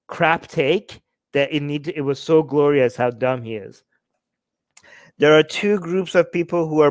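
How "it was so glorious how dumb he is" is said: ordinary delivery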